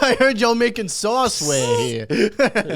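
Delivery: high, loud, drawn-out caricature; exaggerated goombah voice, half laughing; mock Italian-American boss. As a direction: ham up an Italian-American accent slowly.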